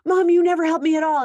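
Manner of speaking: high, loud whiny accusation; mimicking a kid yelling at mom; mock kid complaint. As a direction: whine it like an upset teen. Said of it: "They'll say something stupid like".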